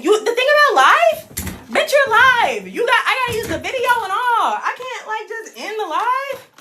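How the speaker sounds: high, laughing chatter about being live. Real, not acted. Vlogger to her viewers, laughing about being live.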